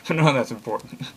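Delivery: usual speaking voice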